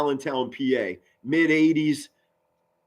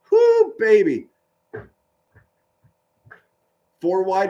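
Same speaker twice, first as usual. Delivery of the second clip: higher, driving sportscaster call; play-by-play commentary; 4 semitones higher than usual. Acted. Streamer calling the Lions game for viewers, broadcaster style.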